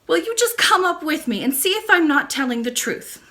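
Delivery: high, eager little princess voice; a hurt girl pressing her case; child princess. Acted. Princess insists to her nurse, high and eager.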